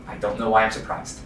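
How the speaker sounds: ordinary talk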